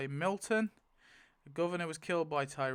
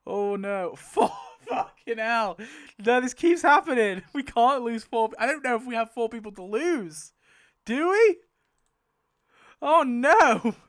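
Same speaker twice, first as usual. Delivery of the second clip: loud, high, incredulous outburst; exasperated wail, rising in pitch; real shocked dismay; 7 semitones higher, 10 dB louder than usual. Real, not acted. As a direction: protest in disbelief at the bad news. Dismayed at losing four characters.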